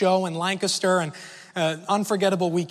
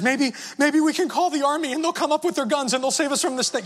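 much higher, earnest panicked hope; desperate plea; 8 semitones higher than usual. Retold or imitated. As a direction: blurt the plan in a frightened rush. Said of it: "she says / She was dead serious".

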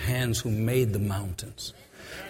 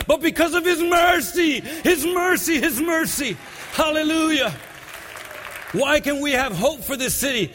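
preaching at full force; 11 semitones higher than usual. Acted. Preacher urging the church that mercy is the reason, shouting high. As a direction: build to a shouting climax.

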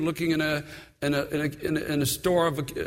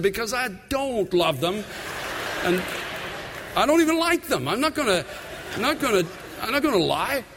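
higher, half-joking protest that he won't say it back; a hint of a defiant younger self, voiced higher; stubborn refusal; 5 semitones higher than usual. Husband answering his wife at church, mock defiant and higher.

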